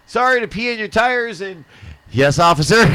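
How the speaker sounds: high, loud sheepish apology. Retold or imitated. Caught by the officer, a high loud nervous apology. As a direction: say sorry like a caught kid. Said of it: "I'm going".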